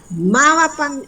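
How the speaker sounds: Mávapa started high and falling; teacher stressing the question word Mávapa; high questioning read. Shown as a demonstration. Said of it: "que hay una pregunta".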